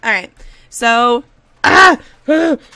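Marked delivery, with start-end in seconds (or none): 1.6-2.0 loud, high gasping breaths; hyperventilating gasps. Real, not acted. Gamer overwhelmed by cute toddlers, hyperventilating gasps. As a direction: gasp repeatedly, overcome with excitement. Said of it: "hyperventilating over you".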